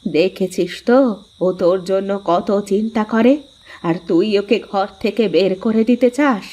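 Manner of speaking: mother scolding son for mistreating his wife; reproachful mother-in-law. Acted. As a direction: shame him as his mother would.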